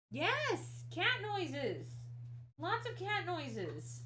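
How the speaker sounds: high, slow cooing; replying to the meowing cat in a high pet voice. Real, not acted.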